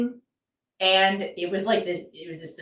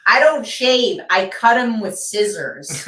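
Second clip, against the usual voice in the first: louder, higher, proudly announced; mock blunt woman; 4 semitones higher, 7 dB louder than usual. Retelling the woman's answer to the comedian, loud mock delivery. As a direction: blurt it out proudly. Imitating the woman's outrageous remark.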